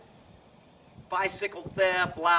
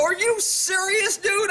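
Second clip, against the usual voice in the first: high, drawn-out incredulous question; retold indignant disbelief; 11 semitones higher, slower than usual. Off-duty cop to suspicious officer, indignant disbelief. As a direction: stretch it out in mock outrage, voice pitched up.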